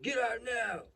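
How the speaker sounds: high-pitched imitation of a cop's yell; barked cop order. Cop orders dad out of the car, yelling. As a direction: act out an urgent police command.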